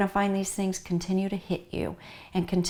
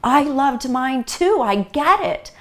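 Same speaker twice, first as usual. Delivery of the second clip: much louder, higher, gushing interruption; eager over-relating; 5 semitones higher, 10 dB louder, slower than usual. Acted. Grief coach acting out a friend who butts in, loud and gushing. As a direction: jump in loudly with emphatic empathy. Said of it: "we just organically wanna say".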